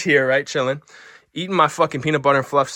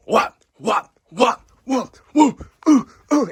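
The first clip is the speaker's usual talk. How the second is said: rising mock impact whoops; acted punch noises; 8 semitones higher, 6 dB louder, much slower than usual. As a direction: voice each blow, climbing higher and louder. Acting out the lunchroom punches.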